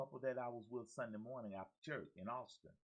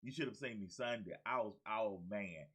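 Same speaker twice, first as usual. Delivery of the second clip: usual speaking voice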